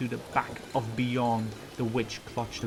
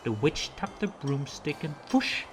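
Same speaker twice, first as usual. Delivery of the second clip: plain reading voice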